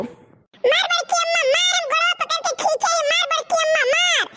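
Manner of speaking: high gleeful egging on; excited shouting. Acted. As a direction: cheer on the beating. Child cheering on the beating. (जोश में उकसाते हुए चिल्लाना)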